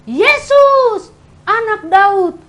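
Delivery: loud, high cry for help, slowing on the second phrase; desperate shout. Acted. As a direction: call out to be heard over a crowd. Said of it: "Dia teriak lagi".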